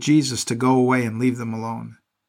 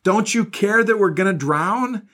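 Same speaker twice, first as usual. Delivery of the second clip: mildly raised, higher-pitched plea; mild narrated shout; 7 semitones higher than usual. A narrator's reading. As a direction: read it as a restrained cry of alarm.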